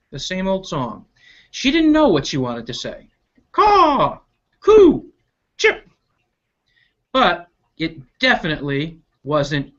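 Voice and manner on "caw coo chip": high chirps and caws in turn; bird calls. Acted. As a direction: rattle off the birdsong. Bird tries other calls.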